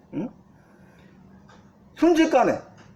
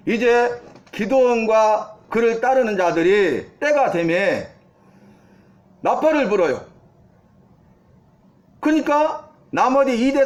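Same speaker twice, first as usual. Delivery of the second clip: ordinary talk, nothing marked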